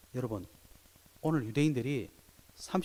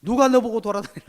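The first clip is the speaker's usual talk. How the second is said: higher, louder furious scolding; angry scolding; 7 semitones higher, 7 dB louder than usual. Retold or imitated. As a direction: snap at the healed man in anger. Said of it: "갑자기 막 화나가지고". (화나서 꾸짖는 흉내)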